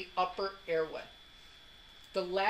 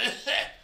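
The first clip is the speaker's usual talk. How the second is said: demonstration cough; 5 semitones higher than usual. Shown as a demonstration. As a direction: cough on purpose to show the class. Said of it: "That was a good cough".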